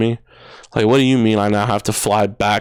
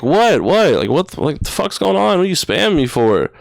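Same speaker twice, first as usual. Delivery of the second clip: higher, sharp, irritated "what, what"; retold angry demand; 7 semitones higher than usual. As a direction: snap at her like you just got woken up. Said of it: "And I'm mad".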